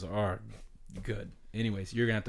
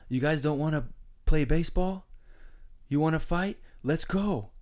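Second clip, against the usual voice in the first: higher, louder fired-up dare to fight; retold challenge yell; 7 semitones higher, 4 dB louder than usual. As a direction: call the whole other team out. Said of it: "I just hear him yelling".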